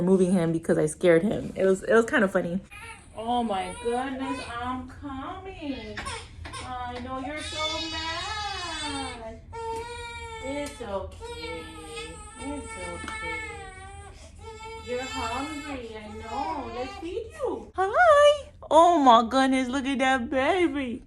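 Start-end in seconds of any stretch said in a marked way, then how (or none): 3.2-16.7 calm him gently while he cries. Real, not acted. Mom soothing crying baby. 16.8-21.0 very high, sing-song cooing. Real, not acted. Mom cooing at baby.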